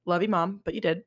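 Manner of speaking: ordinary talk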